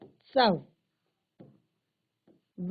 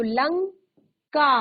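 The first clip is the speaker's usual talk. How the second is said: Lanka said slowly, drawn out for the class; Lanka drawn out long and loud; slow, stretched word; 8 dB louder, much slower than usual. Shown as a demonstration. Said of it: "teacher stretches the first word".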